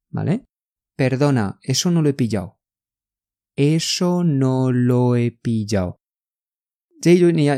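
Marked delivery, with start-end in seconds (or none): 3.5-6.0 slow, drawn-out teaching pace; slow demonstration. Shown as a demonstration. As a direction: spell it out slowly for learners. Slow syllable-by-syllable model.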